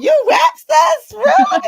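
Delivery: very high, drawn-out disbelief; amazed squeal. Real, not acted. Host amazed that the guest raps, squealing high in disbelief. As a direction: squeal it in astonishment.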